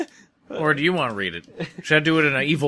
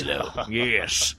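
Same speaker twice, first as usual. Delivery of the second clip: Southern accent; 8 semitones lower, 5 dB quieter, much slower than usual. Shown as a demonstration. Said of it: "that sounds like a Southern guy".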